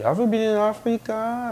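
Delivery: high, sing-song mock nurse question; cheerful nurse. Nurse cheerfully questioning the patient, high mock voice. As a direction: ask it all bright and smiling. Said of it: "tout joyeuse, là, un beau sourire".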